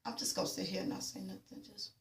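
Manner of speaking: soft mock mutter of disbelief; muttered aside. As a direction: mutter it to yourself, withdrawn. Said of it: "looking like".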